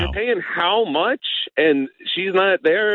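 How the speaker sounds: mild mock outrage; retold disbelief. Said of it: "I was like".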